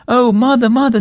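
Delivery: high, loud panicked cry of a little kitten; a frightened kitten calling for her mother. A narrator's reading. Kitten cries out to her mother in fright, high and loud. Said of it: "She seemed to be in a terrible fright".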